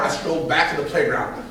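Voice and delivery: usual speaking voice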